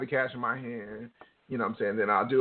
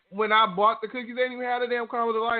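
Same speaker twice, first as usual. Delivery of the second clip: high, fast indignant complaint; 9 semitones higher than usual. Real, not acted. To her co-host about the missing flavour, high and rushed. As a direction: pitch jumps way up, rushed and outraged.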